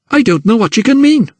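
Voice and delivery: mother to Emily, high and outraged; outraged cry. Acted. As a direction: cry out, offended. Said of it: "cried her outraged mother".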